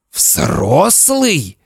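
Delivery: high, incredulous hiss; hissing father. Acted. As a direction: hiss it back at the boy. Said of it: "Папа так зашипел".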